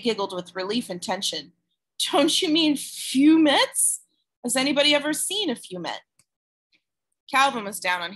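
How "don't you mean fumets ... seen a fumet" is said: playful teasing, high pitched. Acted. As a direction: poke fun at the word. Teasing correction of dragon word.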